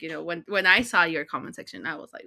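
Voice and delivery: ordinary delivery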